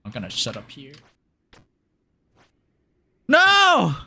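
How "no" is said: very high, loud repeated no; screaming no. Real, not acted. Alone at the end, screaming no in panic.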